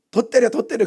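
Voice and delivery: high, loud goading; mock egging on. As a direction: urge it on like a heckler.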